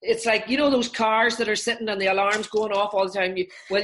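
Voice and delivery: usual speaking voice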